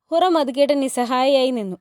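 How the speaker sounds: ordinary delivery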